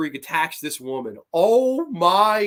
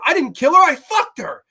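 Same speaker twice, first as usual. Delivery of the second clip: high, loud outburst of denial; anguished outburst; 10 semitones higher, 9 dB louder than usual. Retold or imitated. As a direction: yell it back at the cop. Jeffrey Combs answering the cop.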